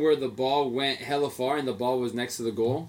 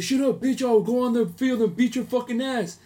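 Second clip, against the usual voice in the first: higher, louder mock threat; imitating drunk threat; 10 semitones higher, 5 dB louder than usual. Drunk fan threatening the player over a ball, mock angry yell.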